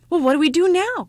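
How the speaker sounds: put-on high, loud, eager child; excited cry. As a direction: ask it bursting with eagerness. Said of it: "cried the ugly child".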